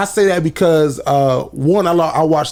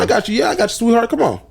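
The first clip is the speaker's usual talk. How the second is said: higher, breezy welcome to fans; upbeat fan greeting; 7 semitones higher than usual. Retold or imitated. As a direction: wave the fans in for pictures. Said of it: "after the show, people wanna take pictures".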